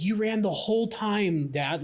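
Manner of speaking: put-on high, slow, excited child's praise; delighted nine-year-old; excited kid. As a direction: say it like a thrilled daughter. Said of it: "super excitedly".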